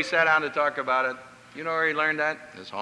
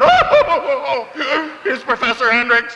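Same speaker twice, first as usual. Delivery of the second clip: flustered hostess; 8 semitones higher, 6 dB louder than usual. Retold or imitated. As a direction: play the mortified mother apologizing to the guest.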